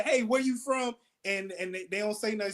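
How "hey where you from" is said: higher, breezy comic asking a question; lightly playing a host working the crowd; mock crowd-work opener. Comic questioning the first audience member, lightly mocking. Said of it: "if you say".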